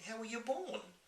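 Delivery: put-on young girl voice. Sophie asks.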